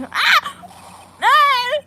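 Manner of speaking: short, loud high-pitched scream; startled scream. Acted. Girl shocked by the hanging scroll, startled scream. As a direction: shriek twice in sudden shock. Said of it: "Why did you scream like that?".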